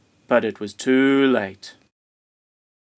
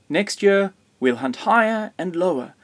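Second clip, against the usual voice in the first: high, sustained exclamation; storybook calling, pitched high throughout; excited cry; 8 semitones higher than usual. A narrator's reading. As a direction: cry it out like a vow. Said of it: "cried Grandfather".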